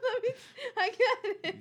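laughing. Real, not acted. Laughing fit.

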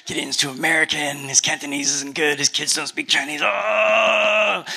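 anxious inner fretting; panicked. Retold or imitated. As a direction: rattle off the worries in a panic. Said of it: "like, panicking in her brain".

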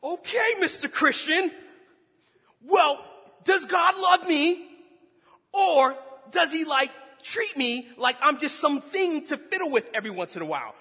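loud, high-pitched sarcastic outburst; indignant rant. Retold or imitated. Angry husband arguing with the preacher out loud, indignant. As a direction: rant at full volume, outraged. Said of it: "in my head and out loud".

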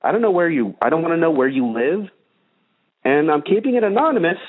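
normal talking voice

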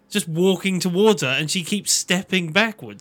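usual speaking voice

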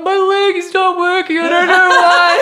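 very high, slow wail breaking into laughter; hammed-up helpless wail, loud and drawn out; retold yelling. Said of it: "I'm yelling at him".

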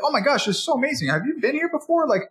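high, fast, thrilled chatter; high excited rush of words; gushing customer. Retold or imitated. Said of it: "By the end of this, they'll be like".

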